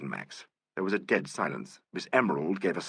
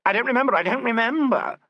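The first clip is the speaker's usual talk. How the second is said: high, loud repeated refusal; hysterical insistence; 7 dB louder than usual. A narrator's reading. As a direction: shriek it again and again.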